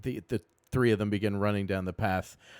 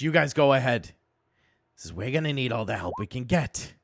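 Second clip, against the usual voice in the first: goblin knight; 5 semitones higher, 7 dB louder than usual. Acted. Knight gives orders and a warning.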